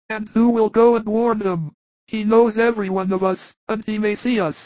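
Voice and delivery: high, worried apostle; Philip's voice. Acted. Philip fearing the Pharisee will recognize them, high voice. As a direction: lift the voice well up for Philip's question. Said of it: "But Philip remarks".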